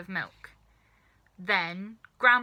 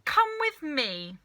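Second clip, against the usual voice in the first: higher, slow, louder yell; 7 semitones higher, 4 dB louder, slower than usual. A narrator's reading. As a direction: summon George urgently. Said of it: "Mister Cranky yelled".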